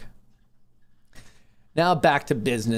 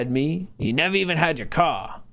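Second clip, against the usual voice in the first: movie character voice; 3 semitones higher than usual. Retold or imitated. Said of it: "Is this now my voice again".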